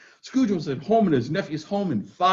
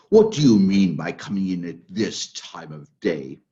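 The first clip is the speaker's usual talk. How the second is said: much lower, slow growl of a stern boss; mock gruff; 14 semitones lower, slower than usual. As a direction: play the old miser stern. Scrooge mock-gruff to Bob.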